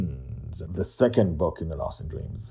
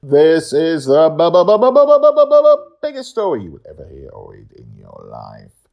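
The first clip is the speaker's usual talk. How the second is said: stuttering, climbing higher and louder, then dropping; mock stutter; 10 semitones higher, 15 dB louder than usual. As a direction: jam on the word for comic suspense. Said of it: "performed stutter build-up".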